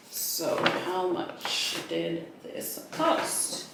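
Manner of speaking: slow conspiratorial whisper about the price; hushed, drawn-out teaser whisper. Shown as a demonstration. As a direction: whisper it slowly, as a secret.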